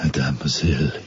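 suave, hammed-up French suitor accent; slow French-accented wooing. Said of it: "in my French accent".

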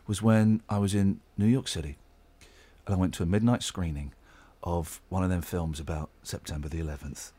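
grave storyteller tone, hushed; serious voice. Acted. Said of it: "serious voice now".

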